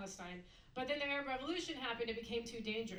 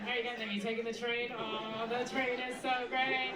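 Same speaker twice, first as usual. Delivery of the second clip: train enthusiast; 6 dB louder than usual. Retold or imitated. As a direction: gush over the train like it's Paris. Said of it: "Every time".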